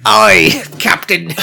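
slow, loud hammed-up English pirate accent; put-on English pirate accent; mock English pirate. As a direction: bellow it like a stage pirate. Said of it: "you went from Australian to English".

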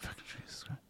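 ordinary delivery

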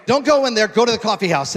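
retelling the Spirit's instruction with full preaching force; loud urgent command. Retold or imitated. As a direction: proclaim it like a divine command. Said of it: "The Holy Spirit told me".

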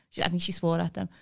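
normal talking voice

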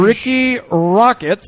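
slow, loud name announcement; news anchor voice. Pretend. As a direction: boom the name like a newsreader. Mock news anchor bit.

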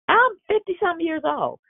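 squeaky jokey aside about her age; mock shriek aside. Real, not acted. Preacher joking to the congregation about her age, squeaky. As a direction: toss it off in a high mock squeal. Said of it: "no, I'm forty-something years old".

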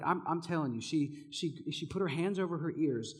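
normal talking voice

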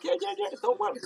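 high, eager instructor impression; mock instructor voice. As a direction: play the overly upbeat instructor. Instructor voiced in retold story.

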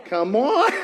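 ordinary delivery

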